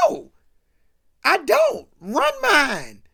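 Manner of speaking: retold refusal. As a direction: shut him down with Run mine. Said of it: "Ronnie tells him".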